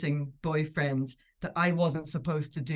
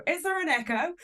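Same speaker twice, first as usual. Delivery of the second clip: a much higher, smug quip; 12 semitones higher than usual. Retold or imitated. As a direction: tease the pundits, pleased with yourself. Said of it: "he'll be very smug".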